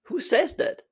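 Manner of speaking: sharp high incredulous question. Real, not acted. Teacher scoffing at the rule, high incredulous. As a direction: scoff at the very idea.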